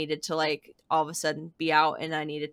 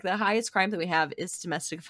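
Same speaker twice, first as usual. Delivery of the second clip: ordinary delivery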